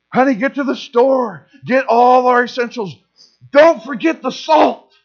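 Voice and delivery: a mock-urgent partner sending you out for supplies; spouse urging. Spouse sent to store voiced.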